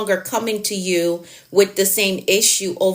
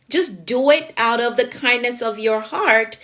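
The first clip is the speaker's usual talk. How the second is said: ordinary talk, nothing marked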